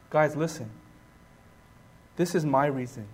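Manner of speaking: low, soft, confiding answer; quiet earnest explanation. Acted. Preacher as Paul telling his friends his reason, quiet and earnest. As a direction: bring it right down and confide.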